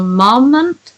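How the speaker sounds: "moment" with its first syllable changed to match "mom"; altered pronunciation. Acted. Reading out a word the find-and-replace broke, altered pronunciation. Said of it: "It changed moment to moment".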